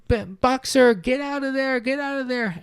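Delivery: very high, urgent cries; frantic calling out. Acted. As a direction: call after him as he's driven off. Animals call out to Boxer.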